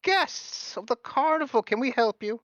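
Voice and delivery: high, eager showman voice; Mister Light, gushing. Acted. Carnival host greeting guests, high and gushing. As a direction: gush over the guests in a lilting high voice. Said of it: "And Mister Light goes".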